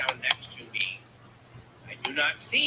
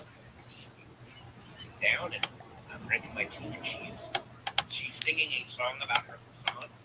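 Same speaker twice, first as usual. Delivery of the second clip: normal talking voice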